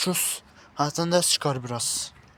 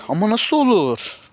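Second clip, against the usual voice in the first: shocked disbelief; 5 semitones higher, 6 dB louder, slower than usual. Acted. Shocked at still wearing sweater.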